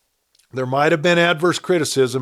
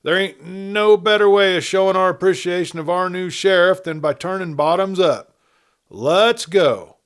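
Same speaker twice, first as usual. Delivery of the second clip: raised, rousing toast; shouted; 6 semitones higher, 5 dB louder than usual. Acted. Celebrant rallying the barroom crowd, loud and rousing. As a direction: cheer the room into drinking. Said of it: "he shouted".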